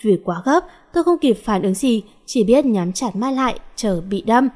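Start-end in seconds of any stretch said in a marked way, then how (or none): none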